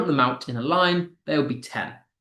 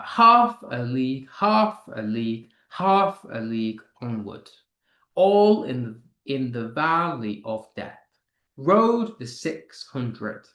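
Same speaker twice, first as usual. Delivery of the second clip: falling rhythm, "half" strong and "a league" light; dactylic stress; 2 semitones lower than usual. Shown as a demonstration. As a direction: hit "half" in "half a league", then two lighter beats. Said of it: "there is a stressed, unstressed, stressed".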